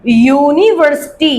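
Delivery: यूनिवर्सिटी said slowly, starting on a clear "yoo"; opening Y sound. Shown as a demonstration. Teacher showing why it takes "a", यूनिवर्सिटी with clear Y onset. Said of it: "ये वाई का sound दे रहा है".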